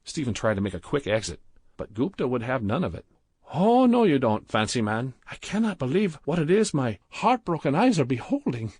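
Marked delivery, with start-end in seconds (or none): 3.5-8.7 put-on high, loud indignant Indian accent; outraged. A narrator's reading. Gupta blocking Stephen's exit, loud indignant Indian accent. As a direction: heartbroken outrage, stop him leaving. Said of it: "started to berate Badrul".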